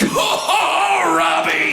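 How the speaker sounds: loud drawn-out "Oh, Robbie"; menacing inner threat. Retold or imitated. Dad silently threatening a boy, loud menace. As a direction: glare at the kid. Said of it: "That was in my head".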